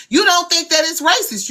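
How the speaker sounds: normal talking voice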